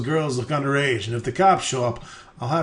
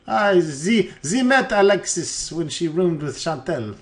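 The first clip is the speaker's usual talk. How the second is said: higher, hammed-up French with ze; bad French accent; 4 semitones higher than usual. Crook recalls meeting Alexis, bad French accent. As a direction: stumble in a fake Frenchman's voice. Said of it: "My Russian and French are identical".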